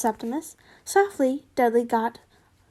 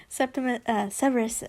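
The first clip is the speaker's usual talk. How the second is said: plain reading voice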